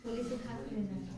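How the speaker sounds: very soft, quick remark; barely audible quick interjection; quiet aside. Real, not acted. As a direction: slip it in quickly under your breath. Aside teacher fails to catch.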